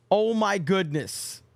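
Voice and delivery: ordinary delivery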